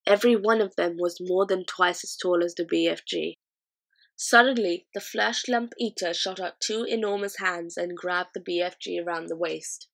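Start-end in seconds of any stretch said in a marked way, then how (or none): none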